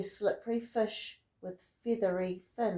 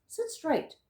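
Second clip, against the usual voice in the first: higher, stern teacher voice; strict teacher; 7 semitones higher than usual. Acted. Teacher ordering the class to sit still. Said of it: "telling the class".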